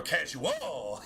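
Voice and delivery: casual with a drawn-out, swooping end; jokey casu-WALL for casual; exaggerated last-syllable stress. Shown as a demonstration. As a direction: overdo the final "-ual" of casual, slowly. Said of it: "nobody says that".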